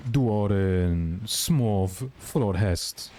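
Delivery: "Du har en smov flodhäst" drawn out very slowly in Swedish; slow exaggerated Swedish demo; Swedish phrase demo. Shown as a demonstration. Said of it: "when you wanna say someone is a".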